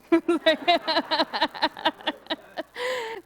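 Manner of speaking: long, loud burst of laughter; real loud, rolling laugh. Real, not acted. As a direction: laugh heartily at your own story. Laughs at own discipline anecdote.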